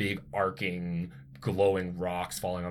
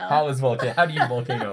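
laughing; 6 semitones higher, 9 dB louder than usual. Real, not acted. Echoing the joke, laughing.

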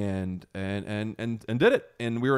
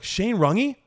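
surprised recognition; 11 semitones higher, 3 dB louder than usual. Retold or imitated. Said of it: "And I was like".

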